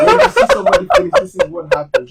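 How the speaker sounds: high, loud grumble through laughter; laughing complaint. Real, not acted. Joking that she has seen him finish, laughing high protest. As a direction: protest while cracking up.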